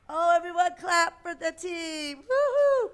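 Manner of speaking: high, loud woo-hoo cheer. Real, not acted. Cheering the team.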